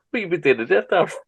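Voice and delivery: words squeezed out through laughs; laughing while acting the exchange. Real, not acted. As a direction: giggle through both voices. Acted Vader exchange while laughing.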